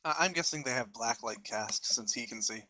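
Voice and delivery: ordinary talk, nothing marked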